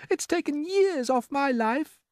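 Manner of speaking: very high, slow and rattled; ladybird voice. A narrator's reading. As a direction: squeak out the fright. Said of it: "the ladybird said".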